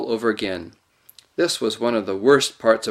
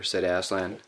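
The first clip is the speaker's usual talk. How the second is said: usual speaking voice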